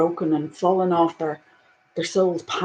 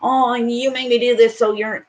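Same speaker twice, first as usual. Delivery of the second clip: nasty accusing whine; 4 semitones higher, 5 dB louder than usual. Pretend. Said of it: "Not in a nasty and aggressive way".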